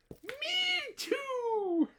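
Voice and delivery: put-on very high, squeaky little-child voice; baby kangaroo. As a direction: squeak it out like a tiny joey. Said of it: "What do you think that little kangaroo said".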